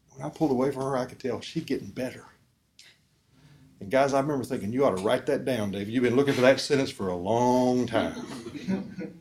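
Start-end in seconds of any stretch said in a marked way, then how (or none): 4.7-9.1 chuckling through the words; amused, laughing inner voice; laughing to himself. Retold or imitated. Preacher laughs while retelling his own thought.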